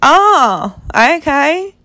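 high, airy fake-polite oh okay; mock polite okay. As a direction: brush it off with forced cheer. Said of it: "I'm just like".

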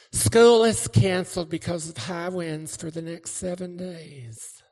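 loud, high announcement voice; hammed-up school intercom voice; school announcer.

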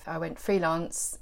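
ordinary delivery

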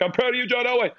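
high, sing-out cheer; imitating co-workers hollering a catchphrase; calling in halls. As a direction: call it out high like a running joke.

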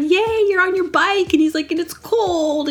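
high delighted yay; cheery yay. Retold or imitated. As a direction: cheer her on like a happy stranger. Cheerful stranger cheering her.

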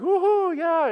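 high whooping cheer, way up; hammed-up woohoo; mock whoop. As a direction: throw out a big fake celebration. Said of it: "get all worked up and like".

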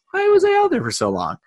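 mock outraged falsetto squeal; exasperated high voice. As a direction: squeak it out, fed up. Retold exasperated complaint.

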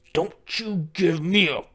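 slow, loud demonic growl. Acted. Wife after husband's thumbs up, demonic growl. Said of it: "and then she growled".

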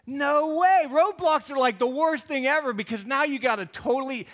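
loud, high, fed-up complaint; exasperated outburst. Pretend. Pastor venting about a roadblock on a road trip, loud and exasperated. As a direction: throw your hands up at the roadblock, loudly. Frustrated driver hits a roadblock.

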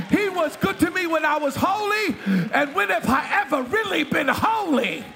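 fiery pulpit preaching; preaching at full force. Acted. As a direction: preach it out to the congregation. Preacher shouting praise.

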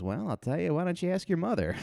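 lightly voiced teacher zinging the student; teacher's dry comeback. Teacher firing back at the cheeky kid, mock smug.

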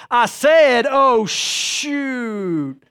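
put-on high, loud sassy kid voice; sassy little girl. Little daughter talking back to her parents, sassy. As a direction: imitate a defiant child, drawing out the words. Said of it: "with sass in her voice and little Miss Macy".

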